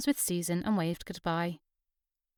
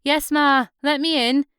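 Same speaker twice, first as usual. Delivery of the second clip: higher, louder call through the door; 7 semitones higher, 8 dB louder than usual. Acted. Susan calling through the door to her mother, louder and higher. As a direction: call to Ma to open up.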